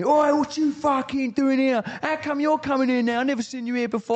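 mock an angry London terrace fan; London football fan accent. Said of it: "That's good accent".

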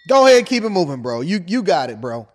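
loud, brushing him off. Acted. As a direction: shout him away. Louder dismissive repeat.

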